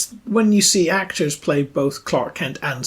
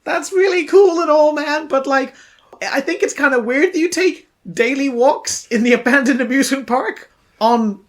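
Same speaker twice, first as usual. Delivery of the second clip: raised, laid-back dude voice; puzzled young dude; 9 semitones higher, 4 dB louder than usual. Acted. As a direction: question the old man like a baffled slacker. Plays the young crossbow man.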